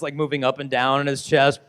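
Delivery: normal talking voice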